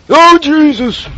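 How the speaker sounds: high loud startled "oh Jesus"; startled shout. Real, not acted. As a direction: jump at the sight. Monster appears suddenly.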